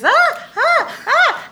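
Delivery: high alarmed ah ah ah; high panicked yelps; warning ah ah ah. Retold or imitated. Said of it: "all I hear is".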